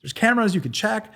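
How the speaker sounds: high-pitched, protesting panic; imitated panic, voice pushed up; mock freaking out. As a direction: act out a man frantically pleading innocence. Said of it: "he's freaking out".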